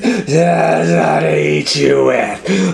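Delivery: very loud, slow wolf voice; menacing wolf roar. Acted. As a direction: pounce with every word. Wolf reveals itself.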